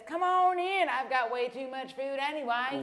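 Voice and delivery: high, loud, drawn-out Southern welcome; hammed-up Southern host inviting you in; Southern accent. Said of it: "That's the worst Southern accent ever".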